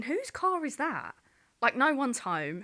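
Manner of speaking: high, slow and baffled; puzzled alarm. Retold or imitated. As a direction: think it aloud, alarmed. Inner thought, car on driveway.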